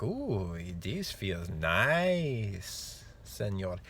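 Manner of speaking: lightly put-on Mexican accent, very slow and relaxed; a lazy frog in a hint of a Mexican accent. Host voicing a frog in warm water, in a lightly put-on Mexican accent. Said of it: "the frog would be like".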